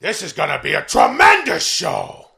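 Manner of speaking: slow, loud and hammy; hammy loud announcement. Acted. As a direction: boom it out slowly. Pacino impression, hammy.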